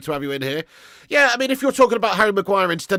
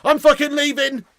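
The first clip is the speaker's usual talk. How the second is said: mock outrage; 10 semitones higher, 3 dB louder than usual. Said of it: "Nah, I'm joking".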